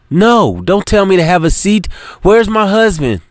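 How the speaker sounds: high, upset wife refusing to sit; defiant and upset, pitched high; angry demand. Acted. Wife demands news of husband.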